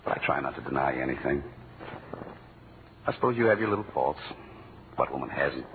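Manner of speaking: low, soft, sincere confession; tender sincere. Acted. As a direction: speak softly from the heart.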